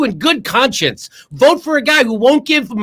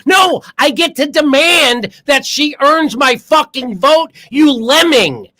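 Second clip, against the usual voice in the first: furious slow shout; 4 semitones higher, slower than usual. Real, not acted. Angry retort to online critics.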